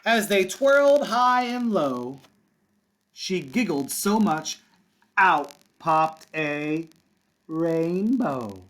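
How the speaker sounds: slow read-aloud with a held pause before the reveal; slow suspenseful storytime. Acted. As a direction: hold back, then reveal the rainbow.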